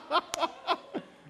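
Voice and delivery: high breathless burst of laughter; real laugh. Real, not acted. Pastor breaks into high breathless laughter at the meme. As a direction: crack up at the absurd picture.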